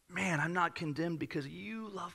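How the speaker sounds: soft, intimate talk to Jesus; personal prayer. Shown as a demonstration. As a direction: drop right down into a hushed prayer. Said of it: "talk to Jesus about it".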